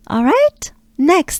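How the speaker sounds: normal talking voice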